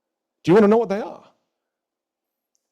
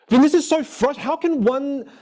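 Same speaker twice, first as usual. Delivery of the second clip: much higher and louder, slowing in exasperation; frustrated outburst; 10 semitones higher, 3 dB louder than usual. Retold or imitated. Student to his coach about lost years, frustrated and loud. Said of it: "And he goes".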